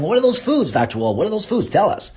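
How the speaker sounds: imagined eager listeners. Acted. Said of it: "some of you are saying".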